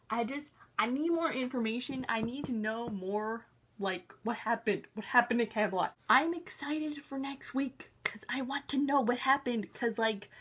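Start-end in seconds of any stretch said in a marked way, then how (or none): none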